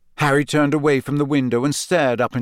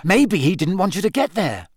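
higher, hurried suggestion; mildly hasty, pitched up; quick interjection; 7 semitones higher, 3 dB louder than usual. A narrator's reading. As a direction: jump in quickly with an idea. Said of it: "said Ron quickly".

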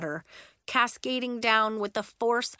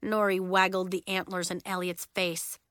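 ordinary delivery, nothing marked

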